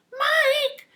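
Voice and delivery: high, loud call up the house; mother calling Mike. Retold or imitated. Parrot copying the mother calling her son, loud and high. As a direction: yell his name like a mom calling. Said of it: "exactly in the mother's voice".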